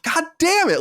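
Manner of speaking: high-pitched exasperated cry; sudden frustrated "God damn it"; exasperated curse. Retold or imitated. Fan learning the host has died, a high exasperated cry. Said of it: "I'm like".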